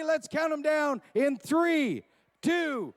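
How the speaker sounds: loud, high, slow countdown shout; hype countdown. Acted. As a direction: count them down at full voice. Countdown to start.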